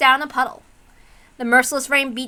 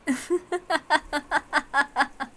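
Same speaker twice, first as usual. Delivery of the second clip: mocking laugh; 3 semitones higher, 5 dB quieter than usual. Villain mocks George.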